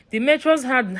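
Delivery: ordinary delivery, nothing marked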